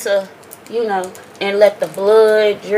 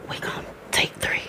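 whispering; 5 semitones lower, 14 dB quieter than usual. Real, not acted. Whispered setup before take.

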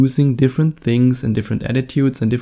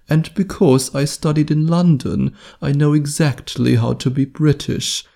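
a bad, put-on British accent, pitched up a bit; a German playing at being posh British; mock British accent; 3 semitones higher than usual. Host showing off his British accent to listeners, a hammed-up British accent. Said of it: "that was the worst British accent ever".

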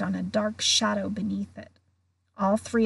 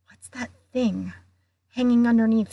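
quivering voice; 3 dB louder than usual. A narrator's reading. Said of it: "a slight quiver in his voice".

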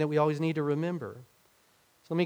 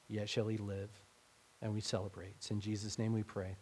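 closing prayer; 3 semitones lower than usual. Acted.

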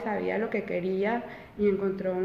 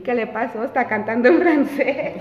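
laughing retelling; 5 semitones higher, 8 dB louder than usual. Retold or imitated. Teacher's quote told laughing.

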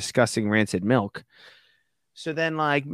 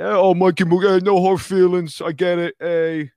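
loud, raised mock Italian-American wiseguy voice; hammed-up Italian bluster, high and loud; Italian accent; 6 semitones higher, 6 dB louder than usual. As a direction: do a broad fake Italian accent. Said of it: "that's my only Italian, uh, accent I can do".

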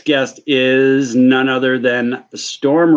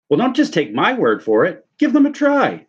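eager ad pitchman; 8 semitones higher than usual. Pretend. Pitchman urging.

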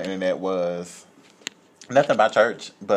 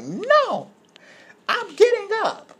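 very high, defiant mock old-lady voice; imitating stubborn woman; slower than usual. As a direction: squeal your refusal to the officer. Retold woman resisting officer.

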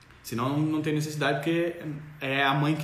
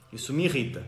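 ordinary delivery

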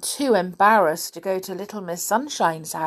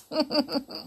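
laugh; 8 semitones higher, 5 dB quieter than usual. Real, not acted. Reader laughs.

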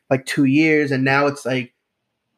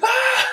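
very high shriek of terror; high scream. Pretend. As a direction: shriek as you're dragged off. Robot dragged off screaming.

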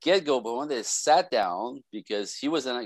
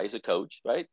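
ordinary talk, nothing marked

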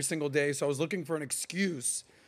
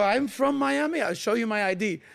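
high, insistent fake French accent; 7 semitones higher, 3 dB louder than usual. Tourist proving his home to a doubter, French accent. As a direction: protest in a raised put-on French accent. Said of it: "that's how good my accent was".

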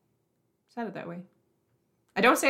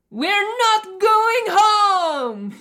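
loud, high, slow proclamation; excited announcement; 12 semitones higher, 10 dB louder, much slower than usual. Acted. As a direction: announce it like a big moment. Reading favorite song title dramatically.

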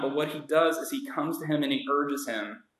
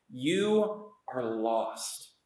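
solemn verdict; 3 semitones lower, 4 dB quieter, much slower than usual. Acted. Jesus's verdict, slow and solemn.

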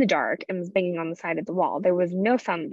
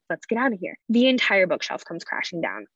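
ordinary talk, nothing marked

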